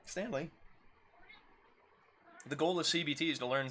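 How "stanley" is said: high call to the cat. Real, not acted. Calling the cat.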